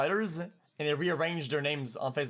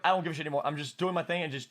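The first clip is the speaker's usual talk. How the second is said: usual speaking voice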